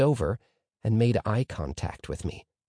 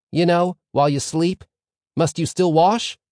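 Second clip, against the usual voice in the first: higher, louder pupil pressing a question; questioning pupil Moshe Tzvi; 7 semitones higher, 6 dB louder than usual. A narrator's reading. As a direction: pipe up with a query in class.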